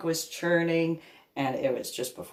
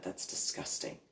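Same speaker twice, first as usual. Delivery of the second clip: disgusted whisper; 12 dB quieter than usual. Real, not acted. Muttered reaction to Pam.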